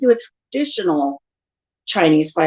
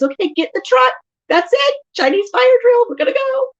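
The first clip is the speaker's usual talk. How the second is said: high, excited call; high-pitched playful orders; rallying the kids; 3 dB louder than usual. Retold or imitated. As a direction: round everyone up to leave. Tells kids to get in truck.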